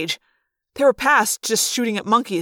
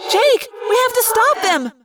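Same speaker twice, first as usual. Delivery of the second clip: very high, loud plea; distraught cry; 14 semitones higher, 5 dB louder than usual. A narrator's reading. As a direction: cry out desperately to a friend. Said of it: "Cassie cried".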